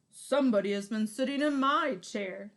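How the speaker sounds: booming papa bear. Acted. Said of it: "said Papa Bear".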